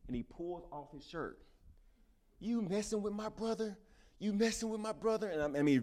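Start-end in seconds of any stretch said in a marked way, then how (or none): 2.4-3.8 much higher, fierce demand; big brother confronting the bully, fierce and high; intense challenge. Acted. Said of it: "very intense". 4.2-5.3 higher and louder repeat of the demand; big brother pressing the bully again, fierce and high; intense challenge. Acted. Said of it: "very intense".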